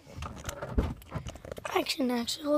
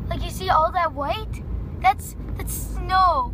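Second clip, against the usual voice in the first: loud, slowed emphatic reveal; excited; 4 semitones higher, 5 dB louder, slower than usual. Real, not acted. Canadian vlogger thrilled by snow, loud and slow. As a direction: show it off with wonder.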